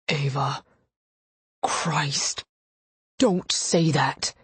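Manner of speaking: pained, halting plea in a man's voice; strained, broken-up plea; breath snagging. A narrator's reading. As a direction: beg her to stop, slowing on the last words. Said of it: "his breath snagged".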